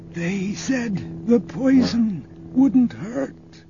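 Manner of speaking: high, slow, crying last words; dying words. A narrator's reading. Dying agent's last words to Luke, high and tearful. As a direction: weep the line as life slips away.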